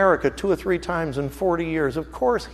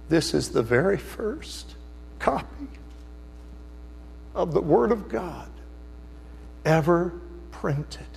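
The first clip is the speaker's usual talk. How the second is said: softer and softer, slow, breaking down to a whisper; choked with tears; 9 dB quieter than usual. Retold or imitated. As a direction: let the emotion swallow the words until they barely come out. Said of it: "and he begins to weep".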